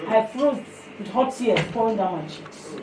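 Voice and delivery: ordinary talk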